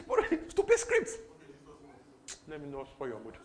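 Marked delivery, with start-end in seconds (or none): 0.1-1.1 high-pitched, loud outburst; mock outrage. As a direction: blurt it out high in exaggerated disgust. Mock outburst at bad scripts while apologising.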